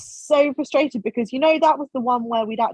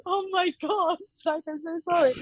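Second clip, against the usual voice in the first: high, softer mock-flustered apology; 8 semitones higher, 4 dB quieter than usual. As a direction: imitate apologising sheepishly in a small high voice. Retold flustered apology to instructor.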